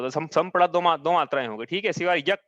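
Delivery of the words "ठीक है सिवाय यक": usual speaking voice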